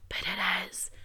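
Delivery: quick stage whisper, teasing; whispered aside. Pretend. Host mock-argues with herself in a sneaky whisper. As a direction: slip the contradiction in under breath.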